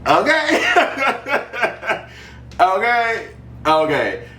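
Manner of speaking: high giggly okay through real laughter; laughing okay. Real, not acted. Reacting to her own joke, high laughing okay.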